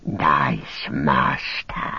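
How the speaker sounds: low, very slow creature whisper; hushed, drawn-out creature voice; whispering Gollum. A narrator's reading. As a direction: draw it out in a fawning hiss. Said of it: "he whispered".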